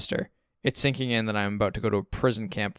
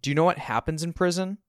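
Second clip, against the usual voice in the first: higher-pitched indignant question; pitched-up, affronted challenge; 7 semitones higher than usual. A narrator's reading. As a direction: challenge dad with it. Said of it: "I repeat indignantly".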